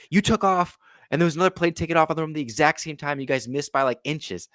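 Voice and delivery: high, loud, fast, urgent; agitated retelling. Retold or imitated. Explaining the near miss to the angry pilot, high loud rush. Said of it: "I'm like".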